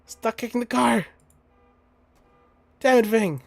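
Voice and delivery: high, loud exasperated yell; high pleading yell. Acted. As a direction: shout at her to stop. Voicing protagonist yelling at Fang.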